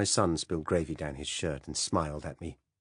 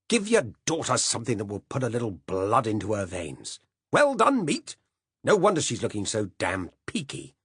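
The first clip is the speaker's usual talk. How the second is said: louder, Well done meat mocked much higher; scornful scolding; 4 semitones higher, 5 dB louder than usual. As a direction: scold her and mock her words.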